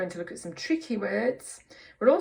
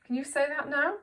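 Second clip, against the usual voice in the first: usual speaking voice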